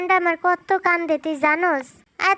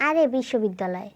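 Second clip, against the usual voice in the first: normal talking voice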